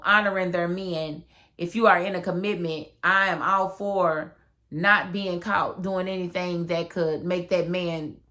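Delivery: ordinary talk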